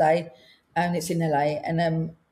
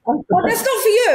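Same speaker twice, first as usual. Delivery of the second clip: high, laughing mock panic; retold embarrassed squeal; flustered protest; 11 semitones higher than usual. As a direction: blurt it out in hammed-up alarm. Retelling her flustered reaction to phone note.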